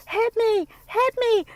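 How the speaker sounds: high-pitched mock pleading; imitated high, taunting voice; mimicking goading women. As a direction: squeal it mockingly like someone egging him on.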